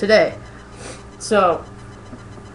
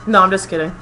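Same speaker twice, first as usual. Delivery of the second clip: ordinary delivery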